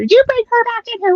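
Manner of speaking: high-pitched imitated yell; mom screaming. Mother on the beach yelling at older sister, high mock yell. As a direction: call her back in like a furious mom on the beach. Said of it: "screaming at my sister".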